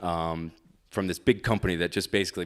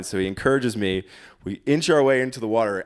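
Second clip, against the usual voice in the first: normal talking voice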